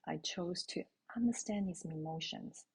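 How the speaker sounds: normal talking voice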